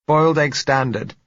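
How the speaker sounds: muffled, from behind a door. Acted. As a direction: speak as if through a closed door. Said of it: "said her muffled voice".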